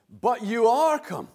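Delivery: But you are come, with are lifted high and loud; stress on are. Acted. Preacher quoting verse twenty-two to the church, stressing are. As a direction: hit are with emphasis. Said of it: "we tried to emphasize it".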